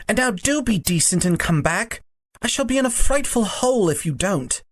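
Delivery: put-on reluctant boy's apology; apologetic Diggory. A narrator's reading. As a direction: apologise as a schoolboy, pitch climbing as you plead. Voices Diggory in the story.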